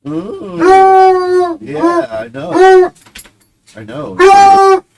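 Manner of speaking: high, squeaky voice for the dog; soothing a whining dog in a high squeaky voice; pet talk. Real, not acted. Cooing to his whining dog.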